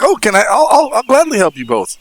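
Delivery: loud, high-pitched eager helpfulness; eager offer. Acted. Guest getting up to help the host, eager offer.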